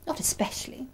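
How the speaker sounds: breathy whisper, dismissive. Acted. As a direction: whisper it, unimpressed. Salty answers dismissively.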